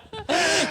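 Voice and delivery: high villain cackle; evil cackle. Pretend. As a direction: cackle like a mad scientist.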